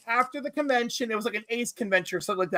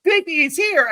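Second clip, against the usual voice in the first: high, eager imitated friend; excited tip-off; 7 semitones higher, 6 dB louder than usual. Friend Dave spotting the guest, mock excited and high. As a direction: announce it like thrilling gossip. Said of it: "And Dave's like".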